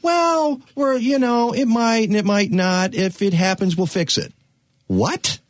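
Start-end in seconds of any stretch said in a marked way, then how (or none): 0.0-4.3 high, dismissive mock voice; mocking shrug. Host mocking careless decision makers in a high, dismissive voice. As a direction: lampoon the careless officials, pitched up high. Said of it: "we look at it and go".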